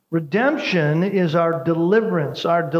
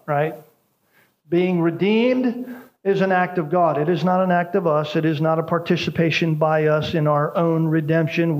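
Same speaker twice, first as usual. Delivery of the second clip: ordinary talk, nothing marked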